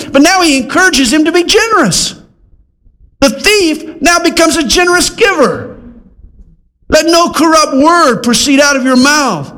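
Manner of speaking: high-pitched, forceful scripture preaching; impassioned preaching. Acted. Preacher expounding the verse on the generous thief, high and impassioned.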